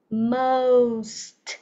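MOST stretched, every end sound clear; correct most. Shown as a demonstration. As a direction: hold the vowel in most, then release st. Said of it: "sờ tờ vô nè". (đọc most chậm, rõ đuôi "st")

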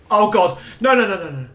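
loud, high burst of no's; panicked refusal; sudden alarm. Real, not acted. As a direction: blurt it in real fright. Monster appears in game.

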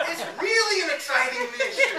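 high, loud giggling words; laughing. Real, not acted. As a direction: laugh it out excitedly. Laughing over the mission.

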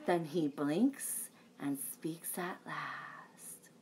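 slow, hushed whisper; slow suspense before he talks. A narrator's reading. Fish finally speaks.

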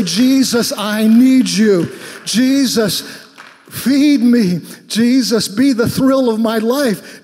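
loud, slow, pleading cry to Jesus; fervent preached prayer. Acted. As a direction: pour it out as a prayer from the pulpit. Prays aloud over music.

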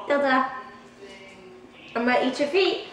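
ordinary talk

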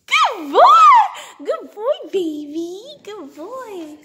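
high squeaky praise for a dog; squeaky praising a dog. Real, not acted. Praising dog after trick.

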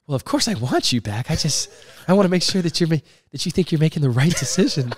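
high, loud put-on coach voice; coach checking in. Coach Maneri questioning his player's decision, high and loud. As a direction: ham up the coach making sure. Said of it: "He's like".

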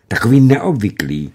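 ordinary delivery

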